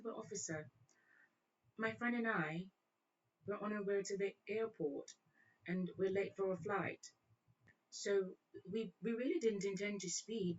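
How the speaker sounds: soft, careful put-on British; British accent. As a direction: plead politely with the officer in mock British. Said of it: "broke in a one British accent".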